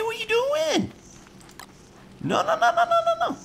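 screaming in real panic as they close in; panicked yelling. Real, not acted. Gamer panicking as snakes attack, frantic high yells.

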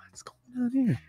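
puzzled viewer's hushed whisper. Retold or imitated. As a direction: whisper it like a baffled onlooker. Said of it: "People are now watching this back going".

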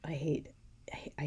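a wry, confiding whisper. Pretend. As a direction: whisper it like a secret complaint. Mocking paraphrase, whispered aside.

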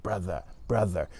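brother, brother, th close to a t; brother with a light th. Shown as a demonstration. Teacher repeats brother softly for students. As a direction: say brother, brother quietly with the tongue out. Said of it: "It becomes the T sound".

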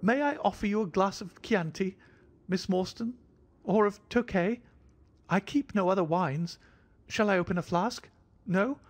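fussy, jittery host bowing and scraping; thin high voice. A narrator's reading. Little man greeting Miss Morstan in a thin high voice. Sholto offers wine.

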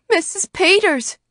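loud, high-pitched alarmed cry. A narrator's reading. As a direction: call her name urgently, high and loud. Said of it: "cried Missus Hale".

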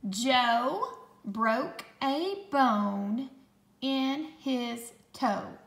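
slow, word-by-word dictation; slow, clear dictation voice; dictation pace. Shown as a demonstration. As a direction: read it out slowly for spelling. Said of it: "Let me say this sentence a couple more times".

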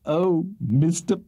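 drawn-out, high, agitated gush; a fluttering, agitated woman's voice, slow and high; trembling agitation. A narrator's reading. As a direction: play overcome with agitation, lingering on each word. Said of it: "trembling with agitation".

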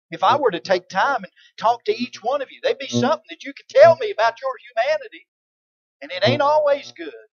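loud, rising preacher's fervor; full-force preaching. Acted. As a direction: preach it out to the church.

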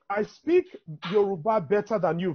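ordinary talk, nothing marked

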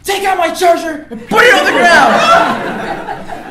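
very high, loud and slow, nearly cracking with laughter; over-the-top shouted demand; laughing shouted command. Retold or imitated. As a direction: bark the order, then crack up. Yelling demand again, laughing.

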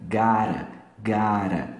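"Gotta" run together as "gara"; joined "gotta". Shown as a demonstration. Teacher showing the preferred joined "gotta", tapped R. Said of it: "prefiro a pronúncia junta".

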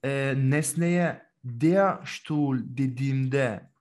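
"der" stretched and stressed, very slow. Shown as a demonstration. Teacher explaining the article, slow with stress on "der". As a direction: drag out "der" so it stands out. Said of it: "bu sandalyeyi ne yapmış oluyorum".

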